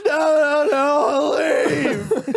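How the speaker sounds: high, drawn-out imitated wail; mock weeping. Weeping woman refusing the comic's help, mock wailing. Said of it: "slowly walks out of the room weeping".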